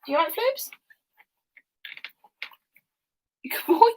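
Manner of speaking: very high doggy talk; pet-talk voice. Real, not acted. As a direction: ask the dog in a tiny high voice. Talking to the dog.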